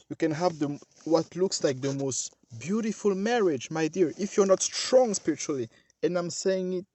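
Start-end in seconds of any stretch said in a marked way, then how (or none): 4.2-5.7 "strong" hit hard with a falling tone; stress on strong. Real, not acted. Preacher warning his flock, stress on strong. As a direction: lean on the word "strong", then let "spiritually" drop. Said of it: "putting the emphasis on the word strong".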